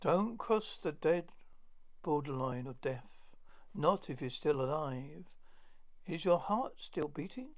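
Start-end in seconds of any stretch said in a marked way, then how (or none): none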